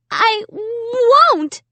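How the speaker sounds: high, broken frightened stammer. A narrator's reading. Amy defying the dummy, a high stammer. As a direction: stumble over the words in fear. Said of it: "I stammered".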